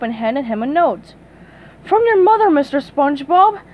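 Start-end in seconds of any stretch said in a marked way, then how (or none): 1.8-3.6 breathless. Acted. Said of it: "she said breathlessly".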